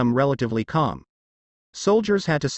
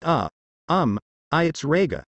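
ordinary delivery

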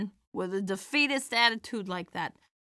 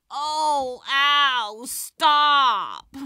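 mock pained whine; 4 semitones higher, 7 dB louder, much slower than usual. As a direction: fake hurt little cry, a bit higher and louder. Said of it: "and I'd be like".

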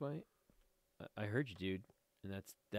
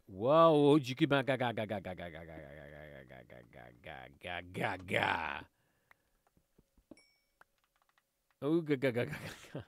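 babbled nonsense syllables; 5 semitones lower, 5 dB louder than usual. Pretend. Player loses his words mid-game, babbles silly ga-ga-ga. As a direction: run of "ga-ga-ga", starting loud then dropping away.

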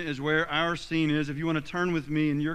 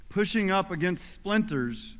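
ordinary talk

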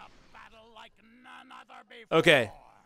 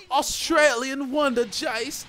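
high, slow mock Australian announcer; a horrible Australian impression, slowed; lightly put-on Australian accent, high and slow; bad Australian accent; 12 semitones higher, 5 dB louder, much slower than usual. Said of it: "Horrible impersonation of Australians".

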